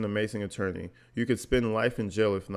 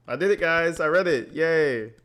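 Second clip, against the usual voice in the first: excited cheer; 6 semitones higher, 4 dB louder than usual. Real, not acted. Celebrating finishing reading.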